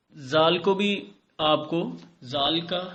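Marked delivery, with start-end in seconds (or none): none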